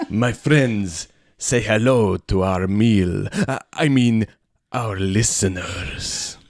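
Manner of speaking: a hint of a Transylvanian count; lightly voiced count, purring greeting; vampire count accent. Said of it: "transition out of this accent".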